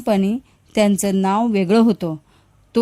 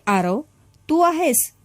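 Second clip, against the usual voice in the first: frightened question, voice rising high at the end; a mother afraid of what she will find; trembling fear; 6 semitones higher than usual. A narrator's reading. Mother calling to a shadow, trembling with fear. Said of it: "Savita थरथरत पुढे चालू लागली".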